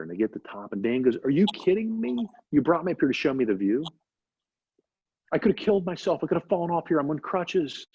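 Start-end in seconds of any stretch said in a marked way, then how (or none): 1.2-3.8 higher-pitched, acted exasperation; mock-outraged complaint at normal volume; indignant disbelief. Dan to his teacher after the climb, acted indignation. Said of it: "He's all pissed off". 5.3-7.9 higher-pitched indignant complaint; acted exasperation. Injured Dan scolding his teacher on the mountain, exasperated. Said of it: "He's all pissed off".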